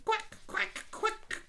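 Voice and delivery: quacks way up high; squeaky little duck calling; cartoon duck quacking high.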